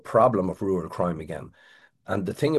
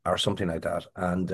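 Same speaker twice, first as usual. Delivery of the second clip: ordinary delivery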